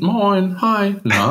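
high, chirpy host greeting; cheery greeting. Pretend. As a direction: greet them like an overeager host. Mock greeting newcomers.

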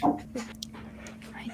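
slow hushed uncertainty; whispering. Real, not acted. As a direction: breathe it out unsure.

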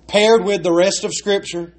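usual speaking voice

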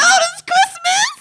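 high, loud, slow mock-crying wail; hammed-up despair, stretched and shouted. To her cohost, about failing on Christmas, a hammed-up wail.

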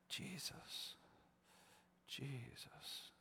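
breathy whispered name, hushed and tender; soft whispered prayer. Retold or imitated. Dad praying softly over his sick child, whispered.

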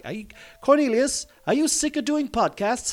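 high-pitched put-on questioner; mock high voice, asking pointedly; imagined heckler. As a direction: pipe it up high, mimicking a nagging questioner.

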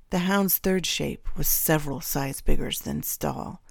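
usual speaking voice

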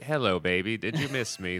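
put-on a lightly done, terrible Australian accent; hint of a hammed-up Australian villain, badly faked. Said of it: "That was a terrible accent".

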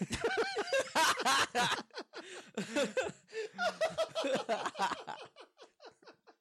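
loud shrieking laugh; helpless high laughter. Real, not acted. Co-host cracking up after his attempt. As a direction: break up completely.

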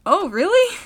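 high, loud surprised question; taken aback, voice jumping up. Real, not acted. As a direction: pitch leaps up in surprise. Learns they have talked an hour.

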